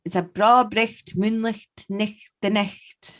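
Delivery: slow, measured Scots with throaty "ch"; Scottish dialect phrase. Shown as a demonstration. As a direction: repeat the Scottish saying slowly for a guesser. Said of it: "Say it again".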